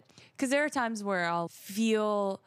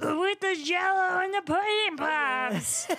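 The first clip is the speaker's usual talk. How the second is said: very high, slow double impression; Bill Cosby doing Bobcat Goldthwait; 10 semitones higher, 4 dB louder, slower than usual. Stacking impressions for the co-host, Cosby as Goldthwait. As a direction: stretch it out in a silly high celebrity parody. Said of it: "Bill Cosby doing Bobcat Goldthwait".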